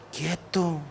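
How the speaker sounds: quieto bajo y suave, casi susurrado; quieto callado. Pretend. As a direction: calma al niño inquieto en voz baja. Calma al impaciente. (aquietando con suavidad)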